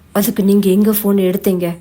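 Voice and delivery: ordinary delivery, nothing marked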